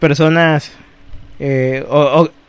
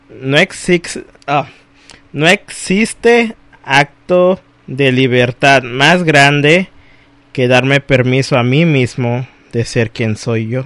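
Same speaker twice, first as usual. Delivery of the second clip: poem recitation; 4 semitones lower, slower than usual. Acted. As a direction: recite it gently and thoughtfully.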